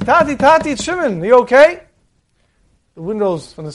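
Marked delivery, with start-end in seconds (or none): 0.0-1.8 higher, louder worried calling through the door; anxious calling out. Acted. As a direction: call up to a father who won't answer. Son banging on father's door.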